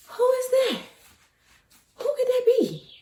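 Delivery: high, puzzled calling out; startled. Retold or imitated. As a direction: call out to an unseen voice, alarmed. Startled, looking around for voice.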